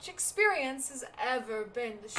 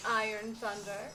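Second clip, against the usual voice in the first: ordinary talk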